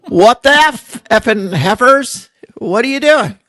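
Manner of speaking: loud, hammed-up exasperation; mock outraged shout. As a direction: bellow it like a fed-up parent. Repeating husband's joke louder.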